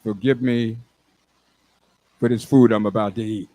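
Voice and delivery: low, solemn bear saying grace; deep, calm grace before the meal; praying bear. Acted. Bear saying grace before eating the hunter, low and solemn. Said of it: "the bear dropped to his knees and said".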